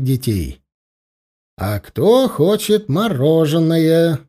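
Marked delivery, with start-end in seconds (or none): 1.6-4.2 slow, drawn-out teasing offer; rally tired children with a treat; rousing call. Acted. Dad perks up tired kids with ice cream, calling temptingly.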